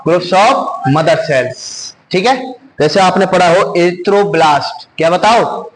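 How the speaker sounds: normal talking voice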